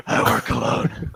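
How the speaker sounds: voiceless, rasped hero impression; comic superhero whisper-growl; Batman. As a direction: rasp it like a dark vigilante. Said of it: "I was gonna do a Batman".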